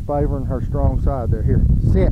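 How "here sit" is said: sharp firm command to the dog; loud command. Real, not acted. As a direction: bark out the command.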